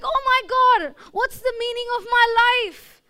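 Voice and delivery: a jilted job seeker wailing about his life; distraught. Retold or imitated. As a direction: pour out hammed-up despair. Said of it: "this guy got so emotional".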